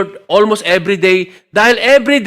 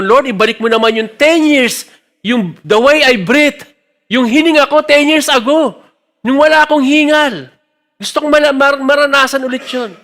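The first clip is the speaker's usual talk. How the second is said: fervent plea; 7 semitones higher, 4 dB louder than usual. Retold or imitated. Retold pleading prayer.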